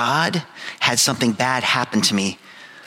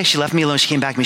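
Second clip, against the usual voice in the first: ordinary talk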